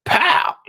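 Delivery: loud, high "Pow!" like a slap; mock slap sound. Narrating the slap on Bobby, loud slap noise.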